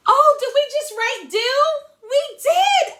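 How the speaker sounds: high, bright gasp of mock surprise. Teacher to young readers, hammed-up surprise. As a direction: act amazed the class knows the word.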